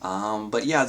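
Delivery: ordinary delivery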